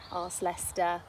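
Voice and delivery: ordinary delivery, nothing marked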